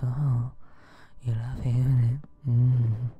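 breathy, slow, teasing sultry voice; sexy voice. Pretend. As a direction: lay on a seductive purr. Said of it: "My sexy little voice".

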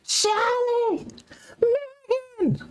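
high, far-carrying mock call for lost family; calling out names. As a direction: yell the names across the water like searching for family. Don't you hear him?.

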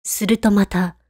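plain reading voice

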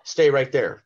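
hooked buyer cutting him off, eager; excited order. Retold or imitated. Restaurant owner stopping the rep, mock eager command. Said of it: "And he just goes".

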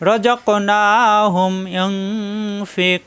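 slow, drawn-out chanted recitation; Quran recitation. Acted. Teacher recites Sura Baqara example, slow chanted tajweed. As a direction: intone it slowly like tajweed recitation.

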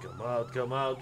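ordinary talk, nothing marked

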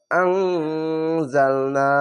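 আংজালনা, very slow, with a sustained nasal "ng"; ikhfa ghunna. Shown as a demonstration. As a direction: hum the ং of আংজালনা long before "zal". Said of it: "আর ইকফা গুণাটি".

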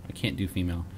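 ordinary talk

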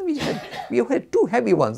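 chuckling through the words; laughing. Acted. Teacher amused at the pile of challenges, laughing. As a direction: laugh as you tell it.

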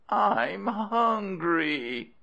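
high, drawn-out whimpering wail. A narrator's reading. Hungry crocodile wife to her husband, whimpering. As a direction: whine it slowly, pitched right up. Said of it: "whimpered Missus Crocodile".

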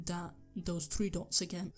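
ordinary talk, nothing marked

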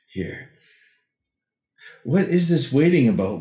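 ordinary talk, nothing marked

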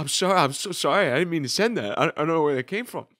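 high, fast, flustered sorry; frantic apology. Retold or imitated. Friend apologizing to the chat, high and flustered. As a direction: gabble the apology in a panic. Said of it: "And he's like".

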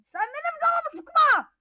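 high-pitched retort, sıkma stressed; squeaky toy snapping back. Acted. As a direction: answer back in a squeaky character voice. Second character answers back.

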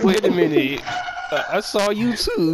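excited. Retold or imitated. Shocked recognition retold.

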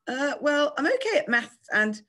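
put-on high, unsure little boy; hesitant. A narrator's reading. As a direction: grope for an answer, timidly. Shy boy hesitates over answer.

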